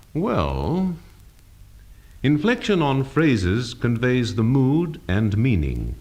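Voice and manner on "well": "Well" curving down and up in uncertainty; doubtful. Shown as a demonstration. Teacher demonstrating compound inflection, doubtful "Well". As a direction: draw out "Well" with a wavering bend. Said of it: "to express doubt or uncertainty, the voice will glide or curve up and down".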